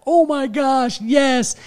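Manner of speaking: very high, gushing excitement; thrilled acceptance. Retold or imitated. Dad offered a video for his daughter, thrilled. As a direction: squeal it like an overjoyed fan.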